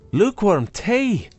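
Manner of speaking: "tea" lifted high in amused disbelief; amused echo. Acted. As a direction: toss "tea" back, laughing at it. Wife repeats insult, amused.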